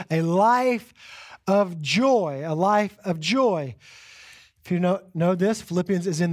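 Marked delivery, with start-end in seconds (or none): 0.1-3.8 A Life of Joy said slowly, each word weighted; slow title emphasis. Shown as a demonstration. Pastor announcing the sermon series title, slow stress.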